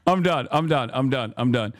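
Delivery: ordinary talk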